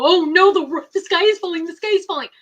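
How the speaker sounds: high, loud mock alarm; mock panic. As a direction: ham up the panicked viewer. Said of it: "They want you to go".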